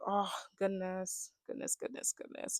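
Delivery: slow, fading into a whisper; hushed dismay. Retold or imitated. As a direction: trail away in a breathy mutter of dismay. Reacting to scared contestant's confession.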